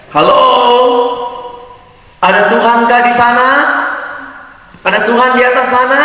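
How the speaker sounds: desperate call into the night; calling out into the dark. Acted. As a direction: shout upward, hoping someone answers. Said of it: "Dia teriak".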